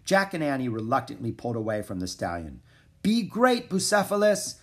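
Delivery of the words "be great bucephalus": high, louder, lightly choked-up call; voice cracking. A narrator's reading. Jack sends Bucephalus off, voice cracking. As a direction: cheer him on with a hint of a crack. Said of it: "his voice cracking".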